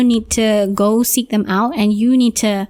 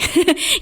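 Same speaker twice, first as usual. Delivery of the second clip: short amused laugh; 7 semitones higher than usual. Real, not acted. Laughing at own remark.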